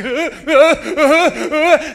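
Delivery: loud comic fake sob; hammed-up blubbering after counseling; mock sobbing. Preacher mimicking crying after counseling, mock sobs. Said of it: "I'm like".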